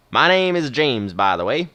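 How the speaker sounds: put-on slow Western old-timer introduction; drawn-out Western movie accent. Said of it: "doing a sort of Western accent".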